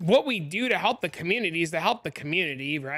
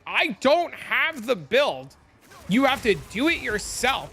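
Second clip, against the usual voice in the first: higher, louder, slow exasperated retort; 7 semitones higher, 5 dB louder than usual. Retold or imitated. Telling the angry viewer off, slow and exasperated. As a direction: spell it out slowly, fed up.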